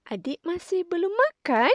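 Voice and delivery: "Adik masih belum makan?" with the pitch rising on "makan"; question tune. Shown as a demonstration. Teacher showing pupils a question, rising on "makan". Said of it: "di belakang tu kita tinggikan sikit intonasinya".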